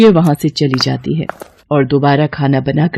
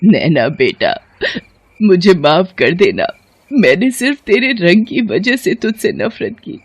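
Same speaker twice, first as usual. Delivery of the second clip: acted sobbing, remorseful mother-in-law; tearful pleading broken by sobs; crying apology; 4 semitones higher than usual. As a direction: beg forgiveness through tears. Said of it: "वो रोते हुए नैना से कहती है".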